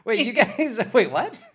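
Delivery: high, laughing disbelief; laughing confusion. Retold or imitated. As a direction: laugh out the question. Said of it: "I'm like".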